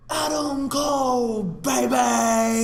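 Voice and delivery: high stretched hype shout; Adam Cole hype call; hype catchphrase yell. Retold or imitated. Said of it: "he goes like".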